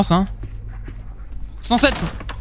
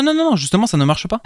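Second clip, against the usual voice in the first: usual speaking voice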